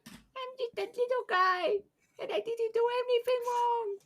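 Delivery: tiny, very high squeaky voice; little guy voice. Acted. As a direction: squeak it out like a teeny innocent creature. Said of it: "say it in your best little guy voice".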